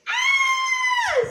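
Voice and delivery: very high, loud mock scream; Goldilocks screaming. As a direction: shriek in fright. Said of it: "screamed Goldilocks".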